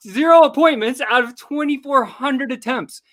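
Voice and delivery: zero pushed high at the start; emphatic zero. Real, not acted. Telling Tigo his cold-call failure, zero stressed high. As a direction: lean on zero, then run on.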